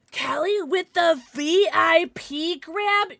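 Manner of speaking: slow, loud hype; excited. Real, not acted. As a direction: drawl it out like an announcer. Cheering a VIP grab.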